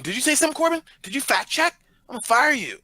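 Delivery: high, pushy mock boss; bossy boss voice. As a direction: scold the fact-checker like a boss. Mock boss scolding an intern.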